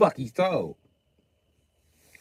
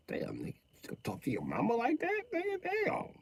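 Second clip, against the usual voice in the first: soft shocked aside; 12 semitones higher, 16 dB quieter than usual. Acted. Bystander reacts shocked.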